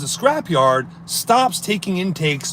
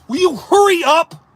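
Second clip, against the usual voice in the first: high, loud exasperated plea; impatient demand; 11 semitones higher, 4 dB louder than usual. Retold or imitated. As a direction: urge her to hurry, fed up. Impatient quote at slow driver.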